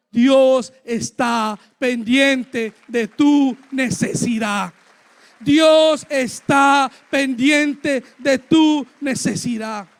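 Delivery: loud, slow, word by word; emphatic proclamation. Real, not acted. Preacher telling each listener God cares, loud and emphatic. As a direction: drive every word home at full preaching force.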